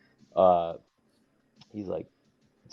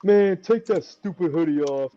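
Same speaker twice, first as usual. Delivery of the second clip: high, slow mock grumble, louder; gruff scolding; 10 semitones higher, 8 dB louder, slower than usual. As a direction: imitate a veteran telling him off. Rodney Mack scolding about hoodie.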